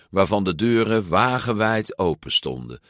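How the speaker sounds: usual speaking voice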